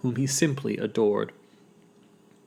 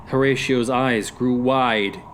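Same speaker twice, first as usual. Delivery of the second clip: plain reading voice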